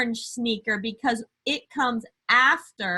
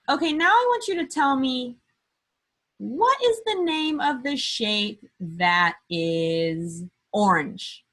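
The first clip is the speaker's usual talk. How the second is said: put-on reading to a toddler; 3 semitones higher, slower than usual. Slow drawn-out question to kids.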